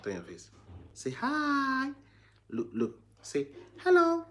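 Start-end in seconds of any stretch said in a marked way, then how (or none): 0.9-1.9 very high coaxing voice. Real, not acted. Coaxing baby. 3.2-4.2 very high, louder coaxing; squeaky high coaxing to his son. Real, not acted. Coaxing baby.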